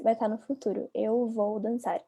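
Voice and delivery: usual speaking voice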